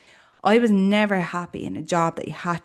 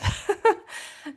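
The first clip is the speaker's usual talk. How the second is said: breathy laugh. Real, not acted. Laughing at her own story.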